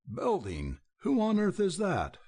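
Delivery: a hoarse, rising cry of disbelief. A narrator's reading. Dick seeing his family, crying out hoarsely. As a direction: cry out hoarsely, pitch shooting up. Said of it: "cried Dick hoarsely".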